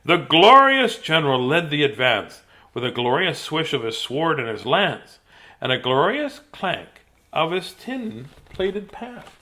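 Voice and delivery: punchy, rhythmic rhyme narration; theatrical storybook delivery; dramatic story reading. Acted. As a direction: read the battle tale with relish. Reads picture book aloud.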